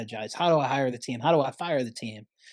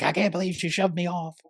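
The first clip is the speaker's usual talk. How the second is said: angry Anna; 6 semitones higher than usual. Retold or imitated. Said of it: "Anna's really angry about it".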